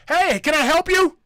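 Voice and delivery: loud and high, shouting at a customer; mock loud shop clerk; loud tone-deaf guy. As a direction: bellow a friendly greeting too loud. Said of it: "He talks to me like this".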